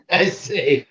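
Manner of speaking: softly hammed-up British accent; mock British accent. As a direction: imitate a stagey Englishman's British accent.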